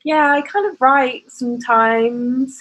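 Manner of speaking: high, slow, shy and coy. Retold or imitated. Telling her future husband she writes, coy and high. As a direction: play down the writing in a high coy voice. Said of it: "I just remember being so coy".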